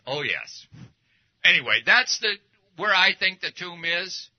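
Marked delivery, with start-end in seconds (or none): 0.1-0.7 a mild Cockney "Oh, yes"; a hint of Cockney; Cockney accent. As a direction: voice the London girlfriend's eager Cockney yes. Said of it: "in a Cockney accent".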